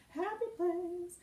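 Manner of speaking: high, drawn-out singsong; singsong chirp. Pretend. Host to viewers after shooing off a nasty commenter, singsong. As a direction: chant it slowly up high, playfully.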